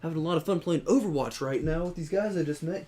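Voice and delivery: happy, enthusiastic gamer voice. Retold or imitated. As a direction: play up being cheerful and having fun. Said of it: "I was being enthusiastic".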